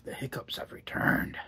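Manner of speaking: slow deadpan whisper. Real, not acted. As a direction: whisper it slowly and dryly. Wry aside about hiccups.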